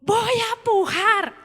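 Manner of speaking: high, loud, slow shout; imitating her shout. The daughter in labour yells to the room, high, loud and slow. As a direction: stretch out the shout. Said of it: "pegó un grito y dijo".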